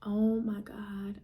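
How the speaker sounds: very slow, breath-only whisper; drawn-out whispered disbelief, re-enacted; stunned whisper. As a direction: whisper it as the news sinks in. Reliving the moment of news.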